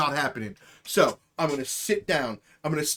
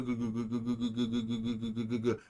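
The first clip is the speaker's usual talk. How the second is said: imitated machine buzz; 5 semitones lower, 8 dB quieter than usual. Imitating neighbor's buzzing machine.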